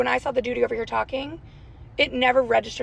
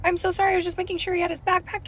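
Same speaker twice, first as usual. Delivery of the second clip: flustered apology; 8 semitones higher, faster than usual. Retold or imitated. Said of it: "I'm like".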